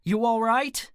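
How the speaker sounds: high, loud worried call. A narrator's reading. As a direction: call loudly through a closed door. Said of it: "I called".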